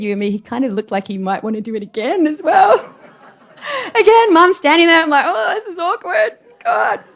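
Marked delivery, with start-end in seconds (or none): 5.3-7.0 awkward inner groan. Retold or imitated. Inner reaction in awkward reunion.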